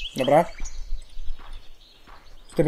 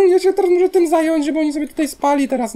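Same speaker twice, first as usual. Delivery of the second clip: high, whiny complaint; exasperated whine; 11 semitones higher, 3 dB louder than usual. Real, not acted. Player grumbling about arranging sleep for villagers, high whine. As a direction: complain with the voice pitched way up. Whining about having to fix it.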